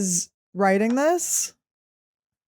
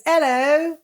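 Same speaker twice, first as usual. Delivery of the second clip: higher, put-on British greeting; British accent; 6 semitones higher than usual. Retelling the British girl's greeting, mock British accent. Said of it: "This is my British accent".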